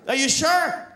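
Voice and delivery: high, questioning; an official asking the little boy; doubtful official. Acted. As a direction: gently check the boy. Official asks kid.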